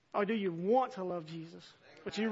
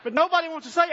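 loud, raised preaching voice; full-force preaching; 4 semitones higher, 7 dB louder than usual. Acted. Preacher challenging his congregation, loud and high. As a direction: hammer it home to the room.

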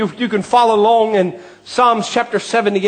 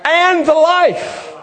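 driving, ringing sermon delivery; impassioned preacher working the congregation; fervent preaching; 3 semitones higher than usual. Real, not acted. Preaches at full force.

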